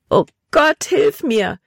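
high-pitched plea for help; desperate cry. A narrator's reading. Terrified girl calls on God for help, desperate cry. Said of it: "rief sie verzweifelt".

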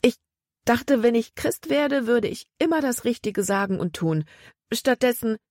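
lightly acted distress, close to tears; mildly upset outpouring. A narrator's reading. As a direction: let it spill out, then break off upset. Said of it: "da sie nicht mehr weitersprechen konnte".

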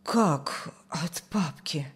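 slow, low, hushed question of shock; stunned. A narrator's reading. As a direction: let the voice drop away in disbelief. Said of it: "Побледнела мать". (потрясённо, еле слышно)

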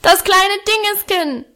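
put-on toddler tantrum. A narrator's reading. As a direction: bellow it like a furious small child. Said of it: "brüllte er".

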